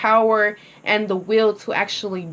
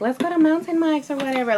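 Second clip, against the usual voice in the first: quick, higher mock boyfriend voice, breezy suggestion; imitating boyfriend; 6 semitones higher, faster than usual. Girlfriend imitating her boyfriend suggesting pizza, quick and high. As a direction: toss off the pizza idea fast and high. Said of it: "my boyfriend was like".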